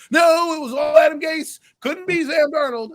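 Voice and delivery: high, loud mock indignation; mocking protest. Mimicking a podcast guest defending Sam Darnold, mock indignant.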